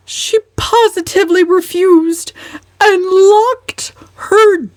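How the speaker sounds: high, loud, slow whimpering lament; whimpering complaint. Acted. Mother complaining about her daughter, whimpering. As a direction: wail out each grievance.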